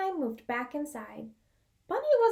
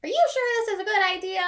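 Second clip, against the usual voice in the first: higher, fast, louder worried question; nervous Porcupine; 7 semitones higher, 6 dB louder, faster than usual. Acted. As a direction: rush it out anxiously. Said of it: "said Porcupine".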